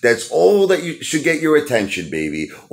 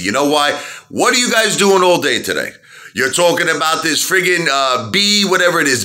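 ordinary talk, nothing marked

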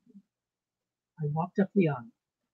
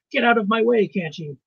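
loud, raised-pitch angry shout; 6 semitones higher, 9 dB louder than usual. A narrator's reading. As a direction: shout someone out of your path.

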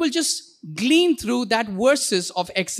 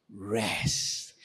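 low breathy whisper; whispered rest; 12 semitones lower, 11 dB quieter than usual. Shown as a demonstration. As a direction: breathe it out like a sigh. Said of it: "restful way".

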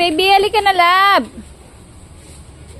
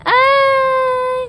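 surprised yelp; 9 semitones higher than usual. Real, not acted. Sudden exclamation during photo.

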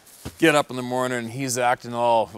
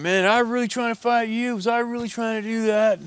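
much higher, louder sorry-for-himself voice; remorseful junkie; 14 semitones higher, 5 dB louder than usual. Retold or imitated. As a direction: play the strung-out guy gushing apologies. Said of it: "he comes up to the wranglers".

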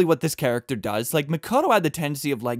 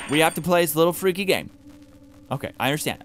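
ordinary delivery

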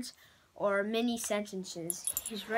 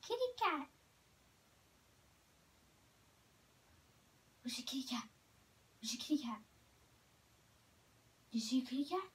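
talking to a dog; 2 semitones higher, 7 dB quieter, faster than usual. Real, not acted. Teasing dog about a cat.

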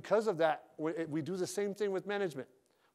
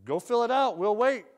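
joking but ticked; 5 semitones higher, 7 dB louder than usual. Retold or imitated. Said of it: "I say it like that. It's kinda funny".

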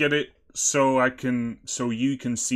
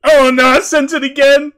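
high, loud alarm; loud, high-pitched panic; panicked cry; 12 semitones higher, 13 dB louder than usual. Acted. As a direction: yelp in dismay at your own mistake. Panics over wrong message sent.

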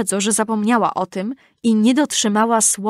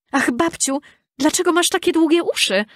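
put-on mildly scared little girl, pitched high; a touch of fright in a child's high voice; frightened exclamation; 8 semitones higher than usual. A narrator's reading. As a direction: ask it in high-pitched alarm. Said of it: "zawołała dziewczynka przestraszona".

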